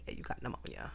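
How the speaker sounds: hushed, low, almost voiceless delivery; soft, low retold bad news; doctors' verdict. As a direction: drop to a near whisper, quoting the diagnosis. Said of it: "that's when they said".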